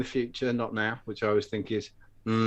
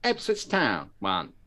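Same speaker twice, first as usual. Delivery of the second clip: drawn-out football results reading; slow imitation of a TV results announcer; really slow; 2 semitones lower, much slower than usual. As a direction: read the scores at half speed. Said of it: "they used to say it really slow as well".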